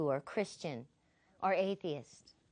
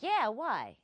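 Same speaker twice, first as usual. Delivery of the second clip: mildly mad; 3 dB louder than usual. Retold or imitated. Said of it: "Now he's getting mad".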